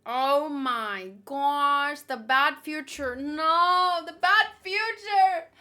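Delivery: high, loud half-crying whine on bad future; hammed-up wail of horror at the picture; mock dread. Dreading the aged Nami drawing.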